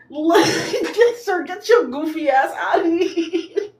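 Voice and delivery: high laughing brush-off; laughing dismissal. Real, not acted. Mocking Chris on the show, laughing as she dismisses him.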